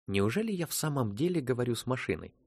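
ordinary delivery

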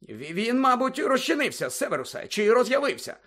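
high, stammering worried official; flustered official. Acted. Fudge flustered, arguing. (розгублений чиновник, високим голосом)

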